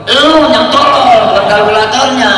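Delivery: playful mock insult, slow and loud; mock scolding retort. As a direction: snap back like a scolding elder. Retort blaming the calculator user.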